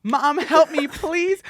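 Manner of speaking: high, loud cry for help to mom; calling downstairs to mom in a panic, loud; retold scream. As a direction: yell for mom like you're scared. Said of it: "I then scream to my mom downstairs".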